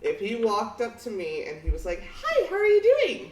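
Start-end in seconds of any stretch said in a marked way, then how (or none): 2.2-3.3 very high, loud squeaky greeting; mock a tall hunk with a tiny voice; high-pitched man voice. Imitating Jason Momoa with a squeaky voice. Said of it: "a high-pitched ass voice".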